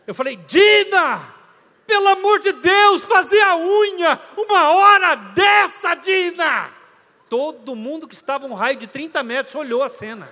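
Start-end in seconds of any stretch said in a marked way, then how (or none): 0.3-6.7 full furious yell at his wife, slow and drawn out; shouting at wife. Retold or imitated. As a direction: bellow her name across the mall in exasperation. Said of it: "Mas primeiro eu vou berrar". (gritando furioso com a esposa)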